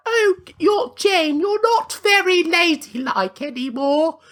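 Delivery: high, slow scolding lady voice; mock-posh high disapproving friends; prissy friends. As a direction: tut at her like a proper Victorian lady. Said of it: "they're like".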